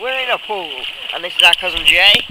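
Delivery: echoey poolside shouting; loud over pool echo; at an indoor pool. Real, not acted. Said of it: "That's dangerous".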